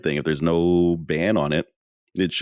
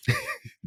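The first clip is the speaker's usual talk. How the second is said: high laugh; 5 dB quieter than usual. Real, not acted. Laughing at the joke.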